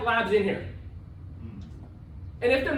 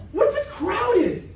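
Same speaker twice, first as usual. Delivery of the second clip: high, drawn-out pretend fretting; exaggerated slow mock panic; mock alarm; 8 semitones higher, slower than usual. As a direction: repeat the worry in a hammed-up high voice. Repeats the silly worry.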